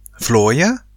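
mildly puzzled, rising question; wondering aloud. A narrator's reading. Boy with itchy spots wondering aloud, puzzled. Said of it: "roep hij zich hardop af".

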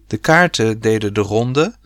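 usual speaking voice

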